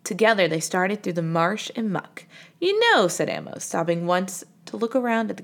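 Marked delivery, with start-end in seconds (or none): 2.6-3.1 eager remark. Acted. Amos points something out.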